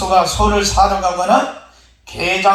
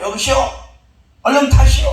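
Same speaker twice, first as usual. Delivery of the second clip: calling out; 6 semitones higher, 4 dB louder, faster than usual. Acted. Rescuer on a boat calls out.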